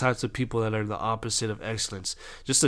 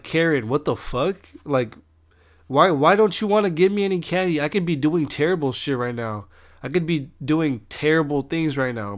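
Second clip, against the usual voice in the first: indignant retort; 5 semitones higher, 4 dB louder than usual. Retold or imitated. Arguing back at candy-refusing neighbor.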